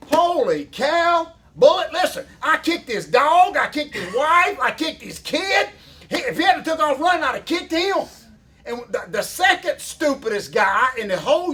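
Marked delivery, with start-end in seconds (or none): 0.1-8.0 comic fury about the Facebook idiot; mock outrage. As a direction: blow up at how stupid that guy was.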